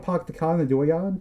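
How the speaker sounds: Boston accent, dropped R's, asked as a question. Shown as a demonstration. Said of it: "there's a lot less R's".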